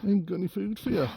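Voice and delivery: high, fast sing-song voice for a pig; pet talk. Real, not acted. Talking to the pig.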